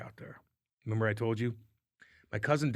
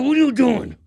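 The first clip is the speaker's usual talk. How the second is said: much higher and louder, furious question; retold angry yell; 12 semitones higher, 11 dB louder than usual. Confronting Chaser after the slash, angry yell. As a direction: yell it at the teammate who broke the stick. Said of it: "I go".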